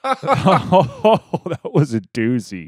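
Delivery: loud laughing exclamation. Real, not acted. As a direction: crack up loudly while reacting. Reacting with laughter to the clip.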